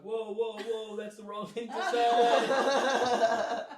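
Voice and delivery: loud, slow laughing whoa; mock-horrified laughing yell; laughing shout. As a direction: laugh it out loud and drawn out, caught out. Reacting to own blunder.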